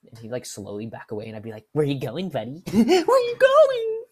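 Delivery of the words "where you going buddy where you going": loud high laughing cry; unhinged laughing taunt; manic taunt. Retold or imitated. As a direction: call after him like a maniac. Chasing coworker who backs away.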